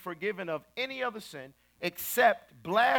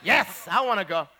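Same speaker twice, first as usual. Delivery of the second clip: emphatic mock answer; loud eager client; 8 dB louder than usual. Client tells TV host she'll confront them, loud. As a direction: blurt it out, imitating a worked-up client.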